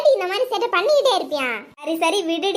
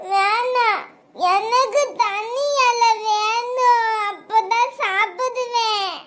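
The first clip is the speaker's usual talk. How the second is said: slow, drawn-out crying demand; tearful child's whining; 4 semitones higher, much slower than usual. Acted. Toddler refusing mother's feeding, demanding own leaf, tearful whine. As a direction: whine and stretch every word like a sulky toddler.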